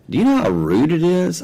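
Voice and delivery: high and loud, a retold rebuke; indignant scolding. Rebuking the zealot for being rude, loud and high. Scolding the man in his retelling.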